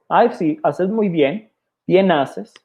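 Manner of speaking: sarcastic pat on the head. Retold or imitated. Preacher voicing James's sarcasm to the congregation, sarcastic mock praise. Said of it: "Santiago sarcásticamente dice".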